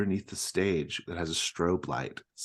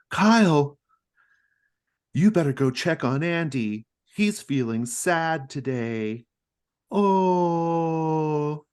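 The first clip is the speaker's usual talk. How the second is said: higher, louder animatronic cow voice; Clara Cow; 11 semitones higher, 9 dB louder, much slower than usual. Acted. Clara Cow tells Kyle to check on Andy, higher louder cartoon voice. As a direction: sing it out as a bright cartoon cow. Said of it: "Clara Cow says".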